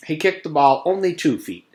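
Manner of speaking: usual speaking voice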